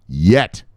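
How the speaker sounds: "yet" punched out loud on its own; stress on yet. Real, not acted. Host stressing there is no ETF yet, "yet" hit loud. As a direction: hit "yet" hard as a loud, one-word repeat. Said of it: "I stress the word yet".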